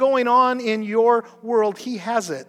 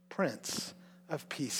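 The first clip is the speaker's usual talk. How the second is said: very soft and slow; hushed slow reverence; reverent; 7 semitones lower, 19 dB quieter, much slower than usual. Real, not acted. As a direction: let the name sink in. Reading scripture names.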